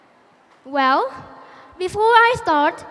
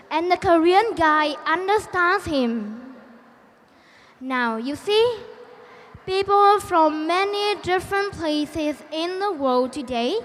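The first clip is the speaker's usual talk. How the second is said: ordinary talk, nothing marked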